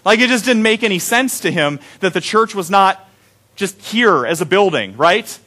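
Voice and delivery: normal talking voice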